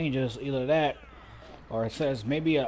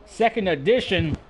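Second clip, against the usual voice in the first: rising excitement on the pin count; higher and louder as the count builds; wrestling commentator; 6 semitones higher, 6 dB louder than usual. Acted. Near fall called in match.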